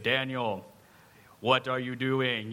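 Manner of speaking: slow, lightly put-on divine voice; hint at a deep movie God, taking your time. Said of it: "I feel like it's a deep voice".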